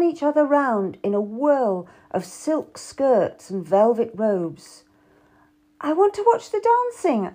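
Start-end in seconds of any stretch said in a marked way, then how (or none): 5.8-7.3 protesting. A narrator's reading. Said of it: "I protested".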